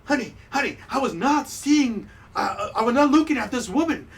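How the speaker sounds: flustered husband. Acted. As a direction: stammer out a guilty excuse. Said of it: "she's like".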